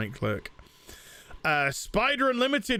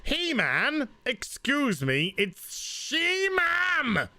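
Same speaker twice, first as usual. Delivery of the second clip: mock She-Man voice; 10 semitones higher, much slower than usual. Reading a joke superchat aloud.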